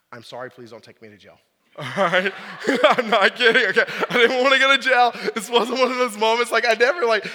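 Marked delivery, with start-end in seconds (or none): 0.1-1.4 much softer, lower, fast sheepish apology; meek plea. Retold or imitated. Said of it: "I took a deep breath". 1.8-5.1 louder, laughing through it; real laughter bubbling into the words. Real, not acted. Laughing at his own story.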